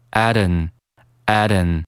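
"Aden" for at an, with the t flowing into an; at an linked. Shown as a demonstration. Teacher demonstrating linking, at an said as "Aden". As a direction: run at and an together as "Aden". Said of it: "At和an连读".

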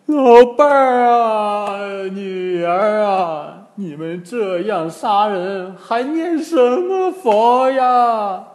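high, drawn-out grieving wail; acted sobbing reproach; loud wailing. A narrator's reading. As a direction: lament the dead, stretching every phrase. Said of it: "拍起胸脯大哭".

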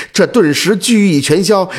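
plain reading voice

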